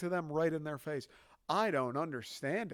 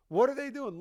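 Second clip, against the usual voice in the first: higher, louder baffled outburst; exasperated; 10 semitones higher, 6 dB louder than usual. Real, not acted. Comic baffled at China's balloon, exasperated outburst. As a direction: throw up your hands in disbelief.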